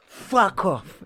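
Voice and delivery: loud, high outburst; imitating a fan's furious bellow; imitated angry shout. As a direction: yell it like a fed-up fan. Retelling an annoyed fan shouting.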